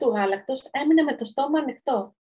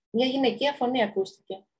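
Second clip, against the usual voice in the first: ordinary delivery, nothing marked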